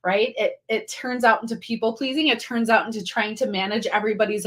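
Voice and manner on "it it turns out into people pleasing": normal talking voice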